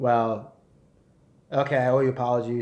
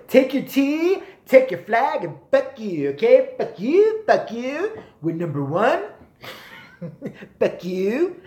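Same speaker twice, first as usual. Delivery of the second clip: higher and louder taunting, brash; mock brash American; 8 semitones higher, 4 dB louder, slower than usual. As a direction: taunt Britain like a cocky younger brother. Mock brash American telling off Britain.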